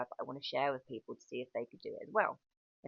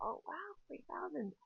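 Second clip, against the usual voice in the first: shocked disbelief; 14 semitones higher, 7 dB quieter than usual. Retold or imitated. Hearing the architect's fee, a high shocked gasp. Quoting own reaction to fee.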